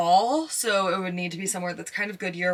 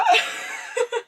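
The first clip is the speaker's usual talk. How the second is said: a high, half-crying laugh; nervous joy breaking out as a laugh; giddy laugh. Real, not acted. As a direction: burst into a shaky high laugh of relief. First booking comes in.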